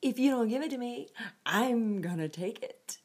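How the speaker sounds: loud, fast and high mock threat; mock threatening. Preacher voicing a God she rejects, mock threat. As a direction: bark it like a bully grabbing something.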